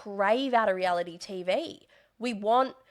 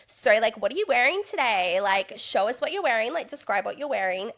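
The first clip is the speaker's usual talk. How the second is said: mock a phony producer's eager prompting; imitating fake producer; 3 semitones higher, 3 dB louder than usual. Producer prompting a contestant about her outfit, mock fake-chatty. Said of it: "they talk to you like this".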